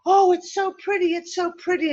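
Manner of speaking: high, sing-out admiration; gushing delight. Retold or imitated. Tourist stepping out of the car to the view, gushing high. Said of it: "I'm all like".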